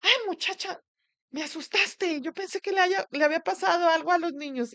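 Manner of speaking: high, loud, imitating her mother's exclaim; relieved scolding mother. Mother scolding daughter for scaring her, high loud relief. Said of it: "mi mamá me dijo".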